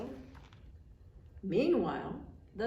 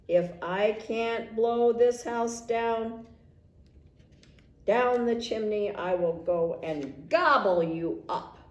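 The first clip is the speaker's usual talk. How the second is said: shouted threat; 2 semitones higher, 7 dB louder than usual. A narrator's reading. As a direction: bellow it down the chimney. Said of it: "shouted down the chimney".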